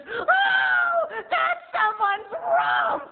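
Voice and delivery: very high, very loud slow shriek; shrieking grandma. Retold or imitated. Grandma recognizing the butt, very high loud slow shriek. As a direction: shriek it out in scandalized shock. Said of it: "she goes".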